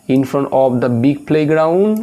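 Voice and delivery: drawn-out answer option; teacher reading options aloud; very slow reading. Acted. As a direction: read each option out slowly.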